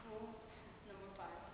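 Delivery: falter, then restate it low and soft; subdued restart. Acted.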